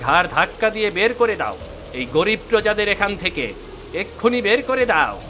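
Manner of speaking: angry order. Acted. Prince orders villagers thrown out.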